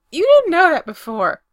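high, loud squeak of surprise; startled squeak. A narrator's reading. Brendan to Spencer on learning of the secret stash, squeaking. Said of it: "Brendan squeaks wide-eyed".

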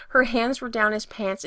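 usual speaking voice

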